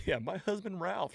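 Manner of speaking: a touch of the neighbour's running gag; lightly imitating; mock Agnes catchphrase. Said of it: "says it the whole thing".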